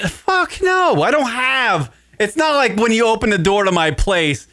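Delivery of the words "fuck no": high, scoffing refusal; indignant no. Real, not acted. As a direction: scoff it off instantly.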